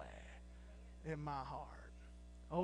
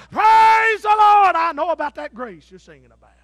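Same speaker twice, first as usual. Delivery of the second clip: standing up shouting; 8 semitones higher, 20 dB louder than usual. Retold or imitated. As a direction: leap up and shout praise in church. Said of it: "he'd stand up".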